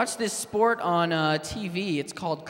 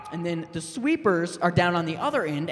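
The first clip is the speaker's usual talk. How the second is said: ordinary talk, nothing marked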